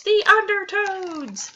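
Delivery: slow, high, loud wail; scream. Acted. Kid screaming at the beach, slow high wail. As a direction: stretch the scare out.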